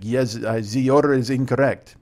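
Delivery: lightly attempted German accent; bad German accent. German customer answering about his order, in a poor German accent. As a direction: do a half-hearted German accent. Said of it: "And he was German".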